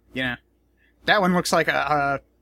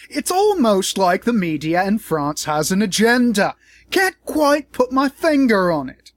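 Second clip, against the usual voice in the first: louder, higher mock British drawl, dragging the last line; bad Paul Joseph Watson impression; 5 semitones higher, 4 dB louder than usual. Vlogger mocking a pundit in a bad British accent. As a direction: ham up a British pundit's smug voice. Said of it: "That was the worst Paul Joseph Watson impression".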